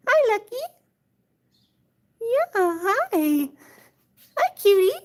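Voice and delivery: high, cooing hellos. Real, not acted. Owner greeting her cat, high cooing. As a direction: coo up at the cat.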